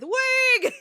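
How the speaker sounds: high loud reply shout; excited shouted reply; answering call. Retold or imitated. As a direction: call the answer back proudly. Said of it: "I will respond with".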